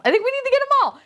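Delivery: very high-pitched giddy repeat; excited squeal. Real, not acted. Collector deciding to buy every set, high giddy squeal.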